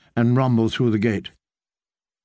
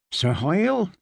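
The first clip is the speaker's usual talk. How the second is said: said stiffly; 5 semitones higher than usual. Acted. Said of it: "she said stiffly".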